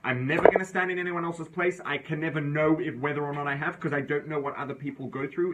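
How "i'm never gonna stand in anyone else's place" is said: ordinary talk, nothing marked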